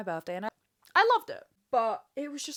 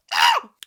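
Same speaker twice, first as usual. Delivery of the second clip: sharp gasp; 12 dB louder than usual. Real, not acted. Sudden excited gasp.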